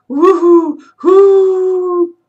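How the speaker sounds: booming drawn-out owl call; loud ghostly hoot. A narrator's reading. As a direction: let the hoot boom and stretch out. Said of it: "Much louder and longer came the sound".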